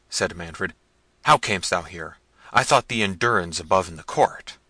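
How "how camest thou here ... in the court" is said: louder, hard questioning; angry demand. Acted. Prince demanding answers from the peasant, loud and angry. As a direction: bear down on him.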